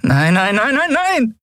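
rapid repeated cries climbing very high; frantic exclamation. A narrator's reading. Endriel loses the dream, crying out in rising panic. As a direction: escalate from dismay into panic. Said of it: "rief Endriel aus".

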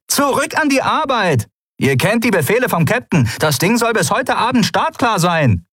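high-pitched shouted orders; calling out. A narrator's reading. Woman at the door to idle pirates, shouting orders. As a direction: order the crew back to work, yelling. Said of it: "rief die klapprige Frau an der Tür wieder".

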